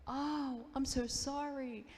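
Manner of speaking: high, drawn-out mock apology; prankster's fake apology. Prankster friend to a stranger on a beach blanket, mock-apologetic. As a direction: imitate a friend gushing a slow, high-pitched fake sorry. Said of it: "she was the instigator".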